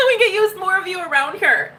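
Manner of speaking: high delighted laugh running into words. Real, not acted. Host delighted by a viewer's attitude, laughing high. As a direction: burst out laughing and keep talking high.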